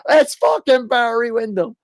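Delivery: mock childish excitement; excited kid. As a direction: yell it running in circles. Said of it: "Be like".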